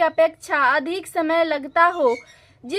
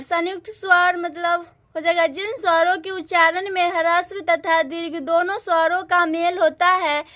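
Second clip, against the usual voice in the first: ordinary delivery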